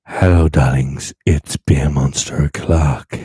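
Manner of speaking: loud, low announcer intro. Shown as a demonstration. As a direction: boom it like a radio host. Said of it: "Do I sound really beautiful now?".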